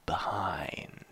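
whispered ominous last word; spooky whisper. Acted. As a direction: breathe it out like a secret. Spooky film synopsis ending.

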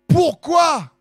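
high, loud cry of why; anguished cry. Acted. Jesus on the cross crying to the Father, anguished loud cry. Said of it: "a crié à Dieu le Père".

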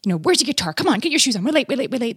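a lightly voiced rushing parent, hurrying the kids; a hint of a harried mom at the door; nagging hurried parent.

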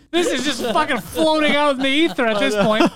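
high laughter spilling into words; cracking up; laughing. Real, not acted. Laughing hard at the chaos.

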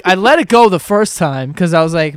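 ordinary delivery

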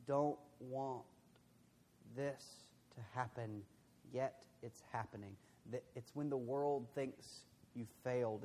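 soft, low, grave reflection; somber preaching. Acted. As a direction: bring it down, heavy and quiet. Somber sermon close on shame.

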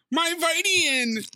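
put-on high, slow childish "My Vitian"; kid mispronouncing vitamin. Lil Tommy whining about his pill, childish voice. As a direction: squeak out "My Vitian" like a little kid. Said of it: "he says".

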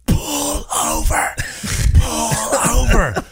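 slow exaggerated mouthed pull over; over-mouth it like a trooper through a window; mouthing cop. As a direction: silent exaggerated lip command. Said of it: "I'm reading his lips now".

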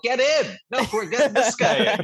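high-pitched, comic panic; hammed-up frantic insistence; mock frantic urging. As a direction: urge them in, squealing and close to laughter. Acts out people in burning car.

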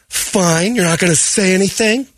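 hammed-up coworker fishing for a compliment; offended coworker. Coworker pressing him for a compliment, mock offended.

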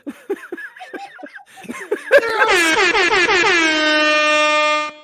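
a long, loud burst of laughter. Real, not acted. Cracking up at his own joke, loud laughing.

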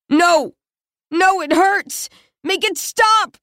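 high, loud panicked cry; a hurt boy begging it to stop; panicked pain. Acted. Stabbed boy begging them to make the pain stop, panicked.

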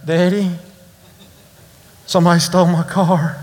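put-on a boy phoning his father, upset; young son calling dad. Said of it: "from that phone booth to say".

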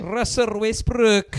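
high, drawn-out "Russell Westbrook" with rolled R's; slow, showy player intro of "Russell Westbrook"; mock arena announcer. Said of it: "he's been doing it for us".

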